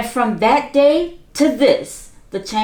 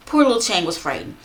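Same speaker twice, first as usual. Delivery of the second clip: plain reading voice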